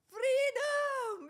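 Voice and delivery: high, loud cry of freedom; triumphant shout. Real, not acted. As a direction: shout it like a victory. Celebrates kids gone.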